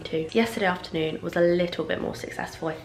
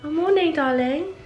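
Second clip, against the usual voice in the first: sweet greeting; 9 semitones higher, 4 dB louder than usual. Real, not acted.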